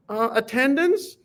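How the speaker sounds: small, high, hesitant question; timid freshman. Retold or imitated. Nervous freshman at the senior classroom door, timidly asking. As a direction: peek in nervously and ask.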